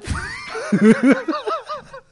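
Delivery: high burst of laughter; real outburst of laughter; shrieking laugh. Real, not acted.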